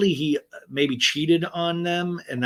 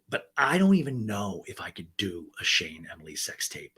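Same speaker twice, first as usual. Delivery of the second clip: usual speaking voice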